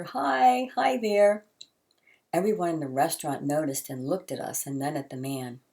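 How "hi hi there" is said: higher, louder gleeful hi; baby's happy answer. A narrator's reading. Baby answering the old man, gleeful and higher. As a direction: chirp it back like a delighted baby. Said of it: "Eric continued to laugh and answer".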